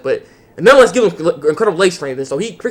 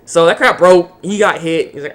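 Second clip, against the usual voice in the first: normal talking voice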